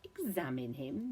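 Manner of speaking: slow, irritated echo of the question; a doctor annoyed at being questioned; tetchy. A narrator's reading. Doctor scoffing at girl's request, slow and tetchy. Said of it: "the doctor said tetchily".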